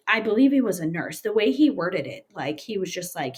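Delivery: ordinary talk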